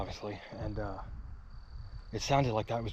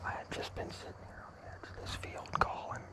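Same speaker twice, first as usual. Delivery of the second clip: hushed whisper in the field; 10 dB quieter than usual. Real, not acted. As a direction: keep it to a breathy whisper. Hunting whisper.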